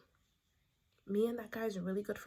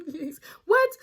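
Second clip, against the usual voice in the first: tearful, high, loud "He's, what?"; crying sob; 9 semitones higher, 13 dB louder than usual. Retold or imitated. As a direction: sob it out through tears. Retelling crying on the phone.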